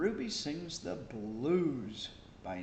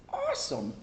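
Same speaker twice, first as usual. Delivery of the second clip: soaring awesome; 3 dB louder than usual. Acted. Climactic praise.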